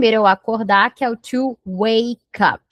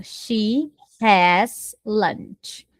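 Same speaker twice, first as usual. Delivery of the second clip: has said slowly and clearly; very slow model sentence for learners; slow, deliberate classroom example; much slower than usual. Shown as a demonstration. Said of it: "Por exemplo".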